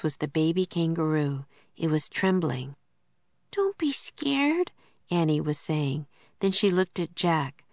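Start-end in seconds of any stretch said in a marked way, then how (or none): 3.5-4.7 high, slow soothing voice; high-pitched, slow reassurance; gentle soothing. A narrator's reading. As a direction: soothe a frightened baby animal, drawn out. Annie soothing a trembling baby kangaroo.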